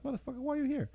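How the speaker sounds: a retold fed-up local, voice jumping high; mock exasperation. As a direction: pitch it way up like you can't believe they came. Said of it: "I'm, I'm like".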